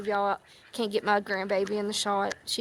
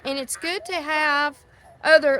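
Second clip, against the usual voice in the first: normal talking voice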